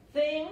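"Thing" loud and high, ending in a sounded ng; final G pronounced. Shown as a demonstration. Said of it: "la G al final se pronuncia".

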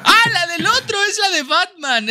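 high, excited blurt; high-pitched eureka; sudden realisation. Real, not acted. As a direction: burst out as it dawns on you.

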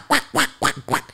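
high, squawky duck quacks; imitated cartoon quacking; Donald Duck. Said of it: "It sounds like Donald Ducks".

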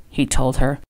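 ordinary delivery

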